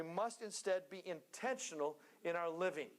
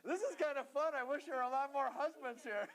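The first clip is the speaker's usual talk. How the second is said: laughing; 7 semitones higher than usual. Real, not acted. Laughing aside to the congregation.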